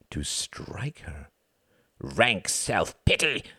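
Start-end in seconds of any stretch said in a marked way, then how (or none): 2.0-3.4 slow, raised inner-demon cry; restrained scream, drawn out; screamed accusation. A narrator's reading. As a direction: spit it out like an inner devil. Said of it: "screamed one of his many devils".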